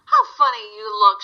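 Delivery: high, laughing dog voice; mocking farm dog. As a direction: tease him like a barking farm dog. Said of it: "she barked".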